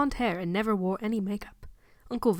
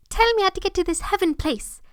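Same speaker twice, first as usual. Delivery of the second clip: put-on much higher child voice, longing and eager; wistful child; 10 semitones higher, 4 dB louder than usual. Boy begging the preacher to show him heaven, wistful high child voice. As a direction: plead it like a yearning little boy. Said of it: "Harry cried wistfully".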